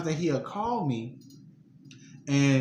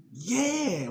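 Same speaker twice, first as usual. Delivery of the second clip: high startled yeah; 11 semitones higher than usual. Retold or imitated. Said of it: "And I was like".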